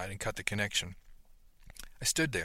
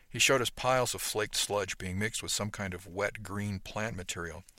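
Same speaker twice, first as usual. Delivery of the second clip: usual speaking voice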